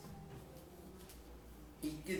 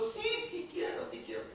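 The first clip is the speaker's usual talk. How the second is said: high, eager yes, sí, sí quiero; mock overjoyed convert leaping at the offer; jumping for joy. Preacher mocking an overjoyed convert, high and eager. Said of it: "Y tú brincaste de gusto".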